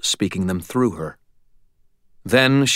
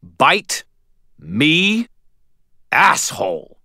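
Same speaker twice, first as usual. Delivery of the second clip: snarled defiance; 8 semitones higher, much slower than usual. A narrator's reading. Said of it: "I snarled through clenched teeth".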